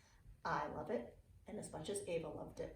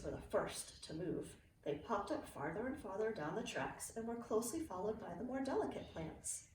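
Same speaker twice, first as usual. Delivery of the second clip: put-on reading to children. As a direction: read it like a bedtime picture book for kids. Reading a picture book aloud.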